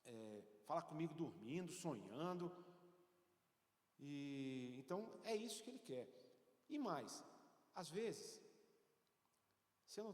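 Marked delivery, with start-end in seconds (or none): none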